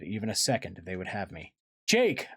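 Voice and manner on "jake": high, urgent call; yelled name. A narrator's reading. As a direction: cry out for help. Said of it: "I heard Marco yell".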